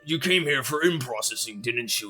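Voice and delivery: lightly voiced orc clerk, friendly and chatty; a hint of a busy clerk checking a list.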